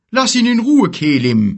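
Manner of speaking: loud, high warning shout; threatening. Acted. Giant defending his friend from the bear, threatening. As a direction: order the bear off forcefully. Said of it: "sagte er drohend".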